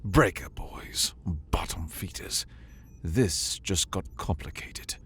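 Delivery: hushed, tense whisper; grim whisper. Acted. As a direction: breathe it out grimly. Zana muttering grimly.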